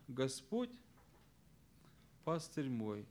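Господь set apart by a long pause, then пастырь мой low and soft; single out Господь, pausing well after it; stress on Господь. Shown as a demonstration. Teacher showing students logical stress, stress on Господь. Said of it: "первое ударение мы ставим на, вот, на Господь".

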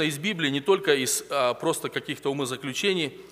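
ordinary talk, nothing marked

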